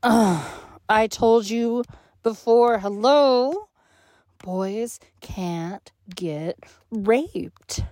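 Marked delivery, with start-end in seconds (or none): 4.4-7.8 very slow, cold and deliberate. Acted. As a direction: spell it out slowly and cruelly. Cruel slow declaration.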